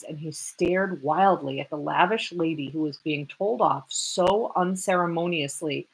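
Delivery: plain reading voice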